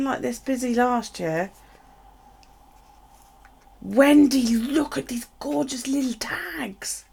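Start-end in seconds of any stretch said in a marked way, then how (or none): 3.8-7.0 excited whisper. Real, not acted. Calls friend to see tags.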